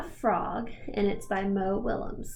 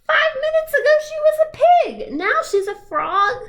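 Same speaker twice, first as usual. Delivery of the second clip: much higher, louder astonished cry; disbelieving; 8 dB louder than usual. Acted. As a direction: exclaim in utter disbelief. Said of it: "He can't believe it".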